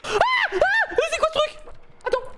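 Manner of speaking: high panicked shriek; terrified shriek. Real, not acted. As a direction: shriek in real fright. Monster appears in horror game.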